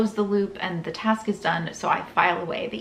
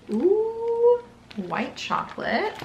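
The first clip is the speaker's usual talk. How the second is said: delighted ooh; much slower than usual. Real, not acted. Opening advent calendar chocolate.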